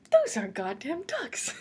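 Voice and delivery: slow, drawn-out, awed; amazed at the ducks. Retold or imitated. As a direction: savour each word, amazed. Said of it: "And I was like".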